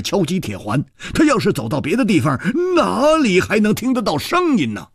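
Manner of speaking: high, animated explaining voice; excitable adventurer making his case; excitable Hu Tiehua. Acted. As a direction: argue the point in a high, agitated voice.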